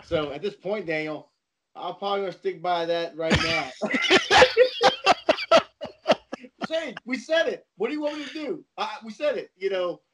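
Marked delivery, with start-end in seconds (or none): 3.7-6.3 high, loud cackling laugh; cracking up hard at his own stubbornness; loud burst of laughter. Real, not acted. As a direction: let a big real laugh burst out.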